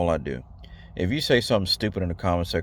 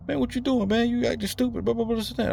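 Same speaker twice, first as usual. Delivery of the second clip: much higher mock yell of an angry man; mock screaming guy. Vlogger mimicking someone screaming at him, high mock yell. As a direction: squeak out a ranting guy's nagging, hammed-up. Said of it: "like, screaming at you".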